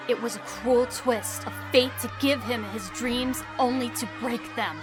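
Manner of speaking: ordinary talk, nothing marked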